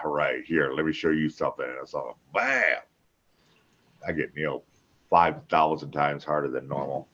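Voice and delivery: usual speaking voice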